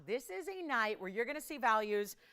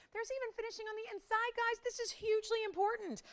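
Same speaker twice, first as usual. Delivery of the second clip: excited; 12 semitones higher than usual. Acted. Host hyping ring feature.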